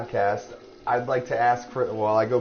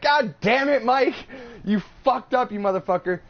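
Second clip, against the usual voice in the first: much higher, louder mock yell at a friend; mock exasperated yell; 12 semitones higher, 5 dB louder than usual. Host scolding Mike for missing podcast day, mock yelling. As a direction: roast him, half-laughing. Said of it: "I'm like".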